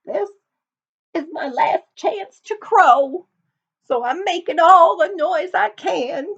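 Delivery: high, loud rooster voice; a desperate rooster making noise; ragged old rooster. Acted. Rooster voice in the story.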